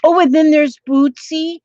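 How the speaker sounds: ordinary delivery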